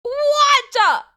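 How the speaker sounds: very high, drawn-out squawk; mock shock. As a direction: squawk in exaggerated disbelief. Repeats exaggerated mock shock.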